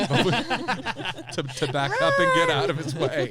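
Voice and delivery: laughing loudly through the words. Real, not acted. Laughs retelling the tenant's lunge.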